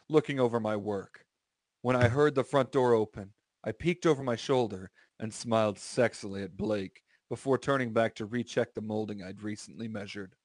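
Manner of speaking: ordinary talk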